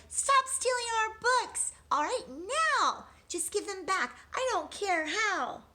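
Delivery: high, loud shout from the window. Acted. Eliza shouting at the book thief outside, high and loud. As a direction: yell it out the window. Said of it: "shouted to the thing outside".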